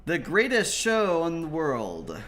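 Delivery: loud, high ringmaster call; booming announcer. Acted. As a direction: boom it out like a circus ringmaster. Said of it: "booming voice".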